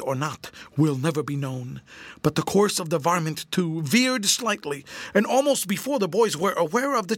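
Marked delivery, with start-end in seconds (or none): none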